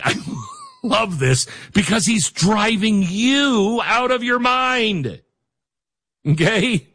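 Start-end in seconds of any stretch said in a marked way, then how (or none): 0.0-5.2 high-pitched mock delight; gleeful fans. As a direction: crow it like thrilled fans. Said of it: "His fans are all like".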